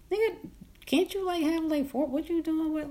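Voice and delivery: lightly voiced puzzled friend; mock questioning. Teasing the boyfriend about a girlfriend, high mock disbelief.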